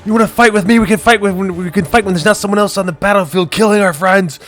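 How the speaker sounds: high, loud furious yelling; angry yelling. Retold or imitated. Knight yelling at his friend on the battlefield. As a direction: tear into him at the top of your lungs. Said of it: "I'm just yelling at you".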